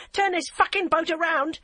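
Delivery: high, loud furious command; furious threat. A narrator's reading. Barbara levels the gun at the DCI, high loud furious. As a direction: threaten her at gunpoint. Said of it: "she cried".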